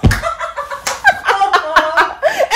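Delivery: high, loud laugh at her own tears; laughing at herself. Real, not acted. Remembering crying at Encanto, loud high laugh. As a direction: burst out laughing while recalling bawling. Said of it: "And I was bawling".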